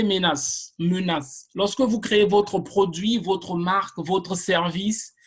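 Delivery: ordinary talk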